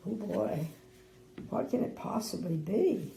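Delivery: plain reading voice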